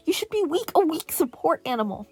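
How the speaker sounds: high, loud insistent yell; villain yelling. A narrator's reading. Villain yelling at Izuku, loud and high. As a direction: hammer the word weak home. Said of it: "the villain yelled".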